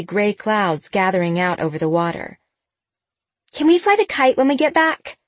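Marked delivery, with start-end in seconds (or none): 3.5-5.2 put-on high, bright young girl voice; eager teen girl. A narrator's reading. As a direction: ask like a hopeful kid. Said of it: "Sabrina asked".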